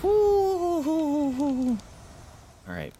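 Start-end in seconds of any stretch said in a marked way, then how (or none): 0.0-1.8 shaken falsetto hoo-hoo-hoo; long high hoot sliding down. Real, not acted. Reacting to fast scary enemy.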